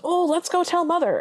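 high-pitched mock Bertha; tattling stepsister. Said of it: "Bertha basically goes".

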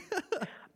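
quick high laugh; a brief surprised chuckle; short amused laugh. Real, not acted. Laughs at movie question.

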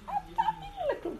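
very high call; soaring exhortation. Acted. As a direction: lift your voice to the heavens. Preacher exhorts.

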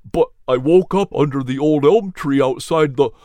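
lightly voiced dazed hound dog, shaken; a hint of a weary dog recounting danger; Nelson's voice. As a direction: recount the fire, still confused. Nelson tells his story.

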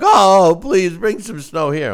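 high mock plea; mock pleading. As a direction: beg for snow in a high voice. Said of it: "you're like".

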